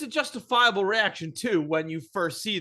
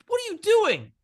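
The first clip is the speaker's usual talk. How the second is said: mom scolding; 7 semitones higher than usual. Retold or imitated. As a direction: tear into the kid like a furious mother. Mom voiced scolding her son.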